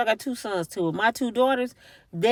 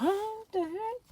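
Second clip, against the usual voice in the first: higher, breathy shock; surprised exclamation; 10 semitones higher, 10 dB quieter, slower than usual. Retold or imitated. Imagining the feds showing up, higher-pitched surprise. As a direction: caught off guard by the feds. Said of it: "You're like".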